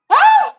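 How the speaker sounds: short high, loud squeal; excited squeal. Real, not acted. Seller delighted by the outfit on camera, excited squeal. Excited reaction while showing off clothes.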